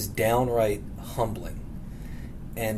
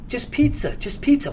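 ski instructor calling; 13 semitones higher than usual. Retold or imitated.

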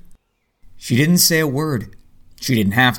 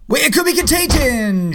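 calling after; 13 semitones higher than usual. Acted. Calls after her leaving.

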